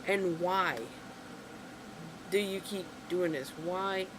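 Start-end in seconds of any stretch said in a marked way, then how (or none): none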